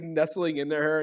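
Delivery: ordinary delivery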